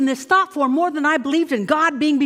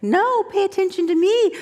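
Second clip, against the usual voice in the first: tempting voice; 6 semitones higher than usual. Acted. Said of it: "got this thing over there that says".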